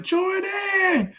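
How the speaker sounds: high-pitched imitation of fans calling out; drawn-out falsetto mock yell. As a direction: call out like a starstruck fan, without real volume. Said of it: "They start yelling".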